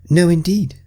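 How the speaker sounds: put-on light child voice for Alice; high girlish voice. Alice answering the Mock Turtle politely.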